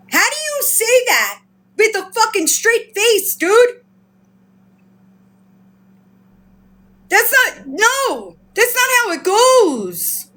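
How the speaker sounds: high, indignant disbelief; exasperated, high-pitched scolding; appalled. Real, not acted. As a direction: protest it in outraged amazement. Appalled at the brazen admission.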